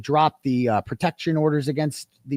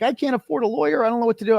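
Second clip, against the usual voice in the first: higher mimicked voice; imitated helpless woman; 7 semitones higher, faster than usual. Woman saying she cannot pay a lawyer, imitated helplessness. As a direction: put on her high, helpless complaint.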